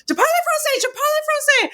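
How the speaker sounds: very high-pitched repeated mock outburst; mock shouting. Someone suddenly shows up on the call box, mock shouting. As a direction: burst in high, imitating an urgent shout. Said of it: "suddenly someone shows up".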